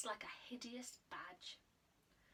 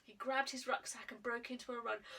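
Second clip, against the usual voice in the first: usual speaking voice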